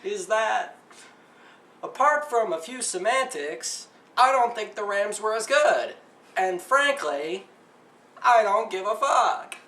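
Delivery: loud, high, slow anchor delivery; mock-pompous anchor, drawn out and projected; douchey news anchor. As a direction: put on an obnoxious newsreader voice, punching each phrase. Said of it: "my douche news, news anchor voice".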